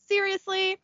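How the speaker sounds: high, soft, deflated question; exasperated sigh. Acted. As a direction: say SERIOUSLY like a resigned complaint. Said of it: "Then he sighs and says".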